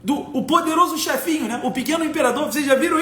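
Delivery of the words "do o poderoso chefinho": ordinary talk, nothing marked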